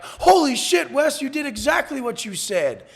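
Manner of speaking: high, loud astonished outburst; retold amazement. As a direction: mimic stunned admirers, starting high and very loud. Retelling their amazed reaction.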